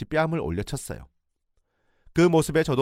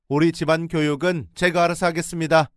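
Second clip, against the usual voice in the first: stern retort; 7 semitones higher, 7 dB louder than usual. Retold or imitated. In-law asserts control.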